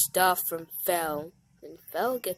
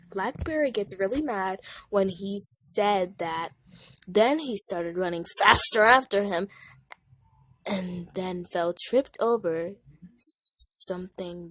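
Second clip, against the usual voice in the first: usual speaking voice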